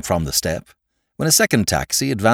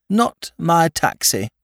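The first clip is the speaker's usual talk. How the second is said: mock solemn drunk; 8 semitones higher, much slower than usual. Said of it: "he exclaimed solemnly".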